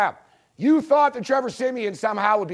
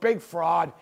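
ordinary talk, nothing marked

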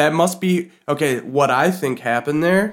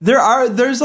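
ordinary delivery

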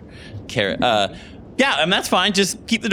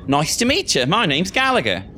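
higher-pitched British student introducing himself; British accent; 6 semitones higher than usual. Acted. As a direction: greet her in a British accent. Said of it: "where's my British accent at".

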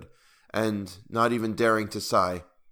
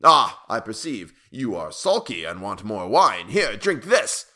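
fierce tyrant; 3 semitones higher, 4 dB louder than usual. A narrator's reading. Tyrant forcing more wine on the dwarf, fierce. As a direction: lash out, slow down, end on a loud order. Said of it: "cried the tyrant fiercely".

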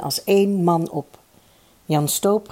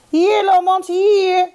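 very high, loud hail; calling out; 14 semitones higher, 6 dB louder, slower than usual. A narrator's reading. The fifth team hails Lomans as he enters, calling out high. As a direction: shout to get someone over. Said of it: "riepen de lui van het vijfde".

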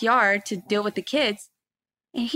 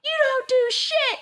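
very high-pitched mock indignation; retold angry retort; 14 semitones higher than usual. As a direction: act out telling off a husband. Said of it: "I was like".

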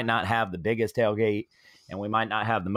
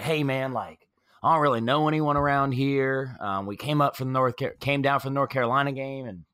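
put-on North Carolina accent; 3 semitones higher than usual. Stranger asking to join the tailgate, North Carolina accent. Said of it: "Your North Carolina accent is on point".

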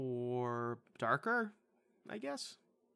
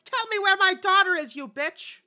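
hammed-up high shrieking yell; mock a bad actress screaming at full volume; 16 dB louder than usual. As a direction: parody of an overacted mother screaming. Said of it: "She's like".

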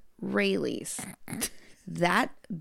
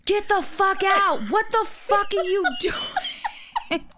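mock yell; 9 semitones higher, slower than usual. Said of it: "I'm like".

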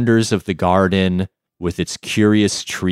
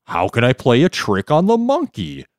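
perplexed jellyfish; 6 semitones higher, 4 dB louder than usual. Acted. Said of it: "said the perplexed jellyfish".

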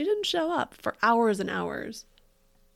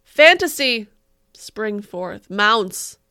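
louder, slow, overwrought recital of a bad poem; mock dramatic poetry reading; 10 dB louder, much slower than usual. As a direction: ham up the tragic verse.

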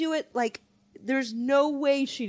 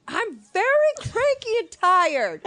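mock scream; 10 semitones higher, 3 dB louder, slower than usual. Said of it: "just screamed".

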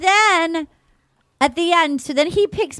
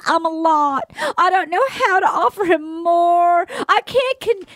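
mock sobbing; 5 semitones higher than usual. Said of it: "She's like".

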